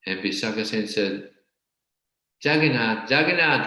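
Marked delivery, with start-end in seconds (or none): 2.4-3.7 scornful scoff. Retold or imitated. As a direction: sneer the name away. Impostor scoffs at the deity.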